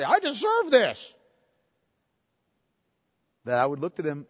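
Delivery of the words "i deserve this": imitating a demanding kid, higher; child's demand. Father mimicking his kids claiming they deserve it, mock whine. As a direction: play the entitled child. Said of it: "when they would say".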